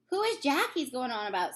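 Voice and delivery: usual speaking voice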